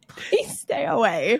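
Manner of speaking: slow mock plea from an annoyed mother; annoyed, pleading mom. Mom shooing the dirty boy, mildly annoyed. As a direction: imitate a fed-up mom, drawing it out. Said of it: "Mom would get so annoyed".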